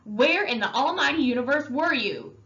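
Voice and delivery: put-on brother's anger mounting at his missing kid; rising anger. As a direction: voice climbing in anger. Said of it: "His voice was rising".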